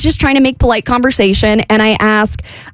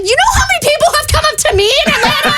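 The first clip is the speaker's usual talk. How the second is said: much higher, fast, laughing indignation; indignant rant; faster than usual. Retold or imitated. Telling the athlete about her own fame, fast laughing indignation. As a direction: rattle it off, half laughing, offended. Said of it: "I'm like".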